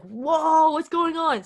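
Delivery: high, loud surprised outburst; startled whoa. Retold or imitated. Messages matching her thoughts, she blurts out, high and startled. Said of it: "So I was like".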